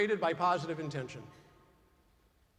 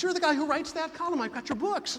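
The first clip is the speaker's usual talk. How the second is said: excited fan; 9 semitones higher than usual. Retold or imitated. Excited fan recognizes the author.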